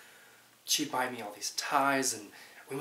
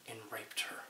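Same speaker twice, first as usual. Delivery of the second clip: whisper; 9 dB quieter than usual. Acted. Horrified confession of the charge.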